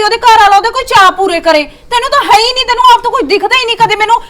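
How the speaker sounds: high, fast complaint; angry accusation. Acted. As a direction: fire the blame at him. Wife accusing husband. (ਉੱਚੀ ਤੇਜ਼ ਸ਼ਿਕਾਇਤ)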